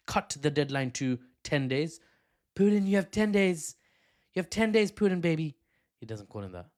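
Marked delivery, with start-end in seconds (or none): 2.5-5.5 louder, higher mock-Trump ultimatum; Trump impression. Trump warning Putin of a deadline, hammed-up impression. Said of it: "I need to work on my Trump accent".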